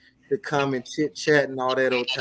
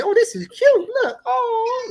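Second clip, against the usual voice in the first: much higher cooing mom voice; 4 dB louder, slower than usual. Retold or imitated. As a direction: gush like a proud mother. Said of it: "the mama filmed it like".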